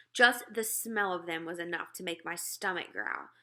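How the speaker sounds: ordinary delivery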